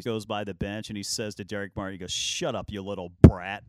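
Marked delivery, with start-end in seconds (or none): 2.1-3.6 snapping, last word shouted out loud; angry taunt. Retold or imitated. Jordan to the trash-talker after the winning shot, a loud taunt. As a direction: bark the insult at him, ending in a yell.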